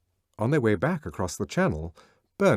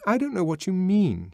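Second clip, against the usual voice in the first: higher, stubborn insistence; repeating stubbornly; 10 semitones higher, 4 dB louder than usual. A narrator's reading. Lenina refusing to understand Bernard, higher. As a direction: say it again, digging in. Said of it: "she repeated".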